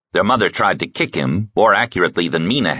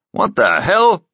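much higher startled cry, leaning on hell; startled outburst; 13 semitones higher, slower than usual. A narrator's reading. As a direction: caught off guard, stress hell. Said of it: "said Broad Oki".

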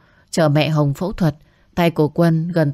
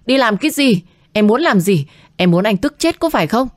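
raised, louder exasperated outburst; angry scolding; 6 semitones higher, 3 dB louder than usual. Acted. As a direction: snap at her in frustration. Boyfriend angrily scolding Hồng.

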